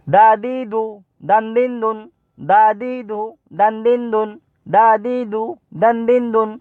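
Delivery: high, level-toned syllable chant; quick recitation drill. Shown as a demonstration. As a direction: recite the short syllables for pupils to copy. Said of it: "হারাকাত এবং তানবিনের উচ্চারণ তাড়াতাড়ি পড়তে হয়".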